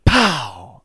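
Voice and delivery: loud, explosive "pow"; sudden punchy sound effect of a blow; imitated impact crack. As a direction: burst it out like a gunshot. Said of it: "You just hear".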